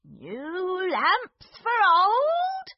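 high, slow street-seller call; old man shout. Acted. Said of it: "he shouted".

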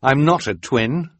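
lightly voiced boy; firm Quigley. A narrator's reading. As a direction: deny it flatly. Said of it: "Quigley said".

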